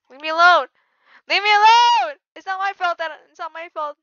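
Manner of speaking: high, loud cry to be left alone; rising yell easing into protest; panicked plea. Real, not acted. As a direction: plead as if being chased. Chased by zombies, pleading loudly.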